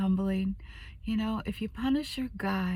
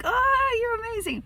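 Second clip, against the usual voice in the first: gushing praise. Retold or imitated. Wife gushing to proud husband, high overjoyed praise. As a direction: overdo the delight like a cheerleader.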